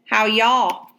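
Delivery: a light Georgia drawl, friendly; an easy Southern howdy; Southern accent. A narrator's reading. Confederate soldier greets Abraham at the fence, light Southern accent. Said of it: "first Southern accent he'd heard".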